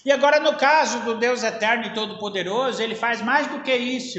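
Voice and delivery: usual speaking voice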